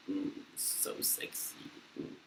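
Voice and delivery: slow, hushed mock-seductive murmur; mock sultry. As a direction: purr it softly as a joke. Sarcasm about own French accent.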